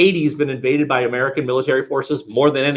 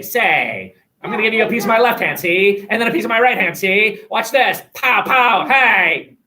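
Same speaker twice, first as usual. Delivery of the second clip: put-on fast, higher old-movie gangster patter; 1920s gangster talk; 5 semitones higher, 3 dB louder than usual. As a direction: rattle it off like a 1920s tough guy. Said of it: "people talked faster, and they'd say".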